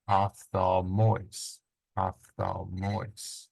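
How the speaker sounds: "ophthalmois" very slow, syllable by syllable, leaning on "mois"; stress on last syllable. Shown as a demonstration. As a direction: sound out "ophthalmois" slowly, final syllable stressed. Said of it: "circumflex at the last syllable".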